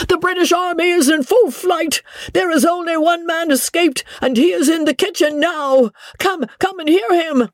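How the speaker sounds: high, alarmed servant's voice; frantic servant. A narrator's reading. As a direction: keep the panicked high shriek going. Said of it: "he shrieked".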